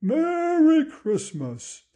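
high, slow, drawn-out farewell call; jolly old Santa. Acted. Santa flies off calling goodbye, jolly and drawn out. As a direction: sing out the greeting as Santa. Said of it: "jolly old laugh like he does".

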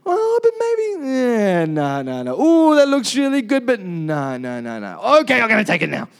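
dithering fish. Retold or imitated. Preacher voices fish eyeing the bait, swooping dithering mock voice. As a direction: hem and haw over the bait like a fussy fish. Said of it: "the fish is gonna be like".

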